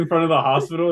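ordinary delivery

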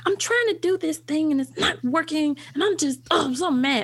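mock toddler frustration; imitating exasperated toddler. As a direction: act out a small child worked up over a problem. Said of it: "she was like".

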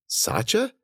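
soft, high rising question; startled disbelief. Acted. Cece recognizes her dog, hushed surprise. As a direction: ask it softly, barely believing.

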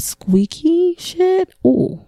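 high, drawn-out squeaky mimicry. Mom recalling her daughter's annoying voice, squeaky. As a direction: stretch it out in a high mock squeak.

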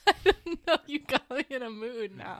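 high laughing voice. Real, not acted. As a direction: laugh through the words. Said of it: "Now I can't stop laughing".

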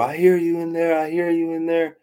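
high-pitched call through a door; retold calling out. Pounding on mom's door at imagined voices, high insistent call. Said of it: "pounding on the door".

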